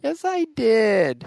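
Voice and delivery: put-on whiny child. Childish playful quarrel.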